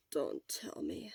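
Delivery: slow, low embarrassed plea; begging softly not to hear; weak plea. Acted.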